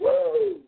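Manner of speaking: high wrestling-promo woo, yelped out; Ric Flair woo. Retold or imitated. Host imitating Ric Flair in a wrestling promo, piercing high woo.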